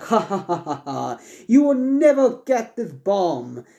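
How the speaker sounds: high, drawn-out villain taunt; hammed-up evil laugh into a slow gloat. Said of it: "Edith just starts laughing".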